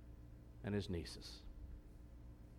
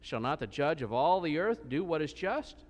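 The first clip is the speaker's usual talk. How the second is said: steady, raised reading voice; scripture reading; 3 semitones higher, 5 dB louder than usual. Acted. Preacher reads Genesis aloud to the congregation, steady reading voice. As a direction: read the Bible passage out to the congregation.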